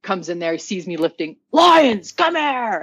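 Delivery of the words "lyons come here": much higher, louder call of her name; coach's summons. Retold or imitated. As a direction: call her out of the weight room.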